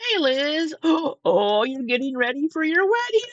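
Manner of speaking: high excited greeting with a gasp. Real, not acted. Reactor greets a viewer about to be married, excited. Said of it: "I am so excited".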